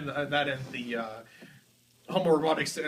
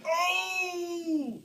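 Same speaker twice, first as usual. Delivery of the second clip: mock excited cry. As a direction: yelp out in fake excitement. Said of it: "I'm gonna be like".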